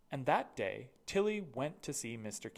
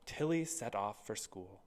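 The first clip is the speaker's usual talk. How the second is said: usual speaking voice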